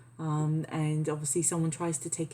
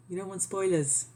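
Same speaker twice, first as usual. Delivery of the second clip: usual speaking voice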